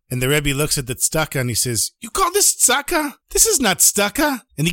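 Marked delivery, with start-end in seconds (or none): 2.0-4.4 high, indignant disbelief; acted scolding in a high voice; scornful rebuke. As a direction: reject the offering with outrage, pitch way up. Rebbe scornfully rejects a gift.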